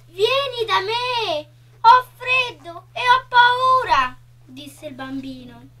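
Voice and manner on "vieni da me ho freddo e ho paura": frightened calling. A narrator's reading. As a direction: cry out for company in fear. Frightened child calls for the girl.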